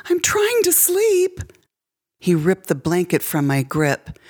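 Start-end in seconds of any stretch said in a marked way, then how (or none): 0.1-1.4 put-on much higher, slower, frightened child voice; a small child pleading from under the covers; scared little girl squeak. A narrator's reading. Girl to her stepfather at her bed, high frightened squeak. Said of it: "I squeaked".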